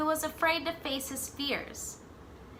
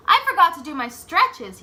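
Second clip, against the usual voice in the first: higher and much louder, a quick excuse; eager excuse; 6 semitones higher, 10 dB louder than usual. Acted. Jabari blurting an excuse to his dad, loud. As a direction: blurt it out like a sudden idea. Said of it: "he said to his dad".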